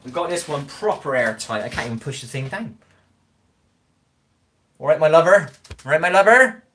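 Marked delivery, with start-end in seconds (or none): 4.8-5.5 loud regional-English greeting, tune falling on LOVER; dialect greeting. Acted. Streamer shows off a local greeting, loud regional accent, LOVER falling. 5.8-6.6 loud, high regional-English greeting, LOVER swooping up; dialect greeting, higher. Acted. Streamer repeats the local greeting higher, regional accent, LOVER rising.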